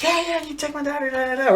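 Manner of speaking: high, lightly voiced mother; a hint of an eager mom agreeing; imitating the mom. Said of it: "her mom was like".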